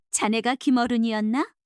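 ordinary delivery, nothing marked

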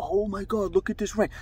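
hushed, high-pitched wonder, acted out; amazed onlookers. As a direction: lightly imitate guests gawking out the window. Voices amazed guests watching rain.

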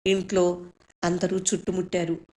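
usual speaking voice

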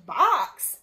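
startled storybook cry; shocked exclamation. A narrator's reading. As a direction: exclaim it in disbelief. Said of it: "exclaimed Missus Rogers".

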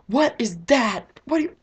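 high, slow, alarmed cry of disgust; freaked out. Retold or imitated. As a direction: recoil from something dead on the ground. Shocked at a dead bird.

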